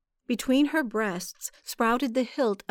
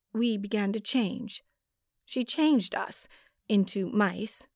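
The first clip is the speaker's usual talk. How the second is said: ordinary delivery, nothing marked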